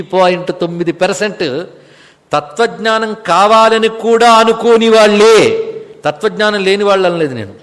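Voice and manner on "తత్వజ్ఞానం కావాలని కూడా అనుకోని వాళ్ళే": కావాలని కూడా drawn out slowly and louder; emphatic stress. Real, not acted. Teacher correcting listeners on who he meant, emphatic slow stress on కావాలని కూడా. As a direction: hammer కావాలని కూడా, stretching it to the end. Correcting what he actually claimed.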